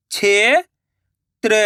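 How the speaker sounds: क्षेत्र drawn out slowly, sound by sound; slow syllable split. Shown as a demonstration. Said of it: "मैं किस तरीके से".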